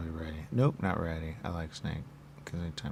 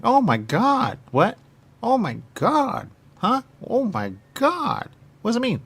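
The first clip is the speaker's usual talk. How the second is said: loud, higher exclamations of shock; startled outburst; 7 semitones higher, 6 dB louder than usual. Real, not acted. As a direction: blurt it out, stunned.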